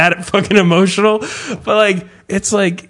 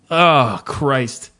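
ordinary talk, nothing marked

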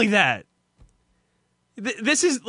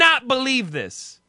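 angry; 5 semitones higher than usual. Retold or imitated. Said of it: "They never say "don't" when they're angry".

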